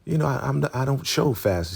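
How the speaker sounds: voicing Ruth. Ruth to the Elder Mother about her pregnancy, lightly voiced excuse. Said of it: "Ruth is like".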